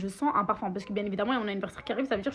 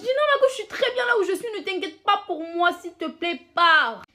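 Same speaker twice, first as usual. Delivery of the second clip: much higher, louder pleading; exasperated plea; 8 semitones higher, 5 dB louder than usual. Retold or imitated. Telling the two women to leave her alone, high exasperated plea. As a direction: beg them to go, voice pitched way up.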